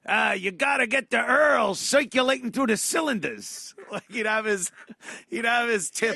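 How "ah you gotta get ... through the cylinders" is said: pontificate like a clueless uncle; mock know-it-all uncle. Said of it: "looking and going".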